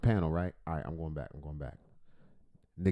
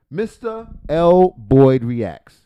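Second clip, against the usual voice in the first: put-on loud, slow hype announcement of "Mister L Boyd Reacts"; announcer intro; 2 semitones higher, 11 dB louder, much slower than usual. Announcing the channel name loudly.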